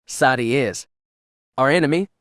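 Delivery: mildly spat, rising in force. A narrator's reading. Kira insisting to Avarice about Sadi, spat. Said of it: "Kira spat".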